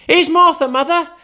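put-on very high, loud excited children; small kids gleefully shouting the news; children crying out. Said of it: "cried the two young Cratchits".

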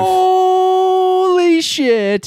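long, high stunned outburst; shocked. Real, not acted. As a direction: blurt it out in disbelief. Reacts to baby news.

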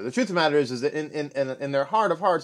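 ordinary talk, nothing marked

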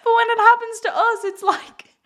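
high, giggling through the words; real laughter breaking in; laughing. Real, not acted. Admitting self-cruelty while cracking up, high and giggly. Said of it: "I'm literally tearing up 'cause this is so funny".

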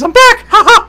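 high, loud, gleeful cartoon cheer with a laugh; cartoonish Disney voice. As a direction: squeal it like a Disney mascot. [name] back with Mickey ears.

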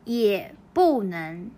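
就算在減肥 drawn out syllable by syllable; slow model reading. Shown as a demonstration. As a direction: read it slowly for learners to copy. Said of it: "たとえダイエットしてると言っても". (ゆっくり模範読み)

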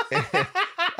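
high, far-off laughing burst; burst of laughter. Real, not acted. Laughing at his own story.

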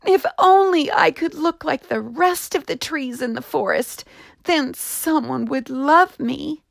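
high, wistful small voice; little tree. Acted. Juniper wishing to look like the others, high and wistful. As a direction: plead like a lonely small tree.

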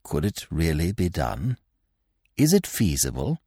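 low, hushed near-whisper. A narrator's reading. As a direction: drop to a soft, secretive undertone. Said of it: "in an almost inaudible whisper".

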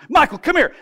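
Jesus excitedly calling the archangel over, high and loud; eager calling. Retold or imitated. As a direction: call Michael over excitedly. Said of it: "picture Jesus doing this".

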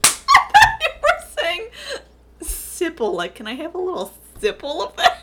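high and loud through helpless laughter; can barely get the words out for laughing. Real, not acted. Misheard word, cracking up.

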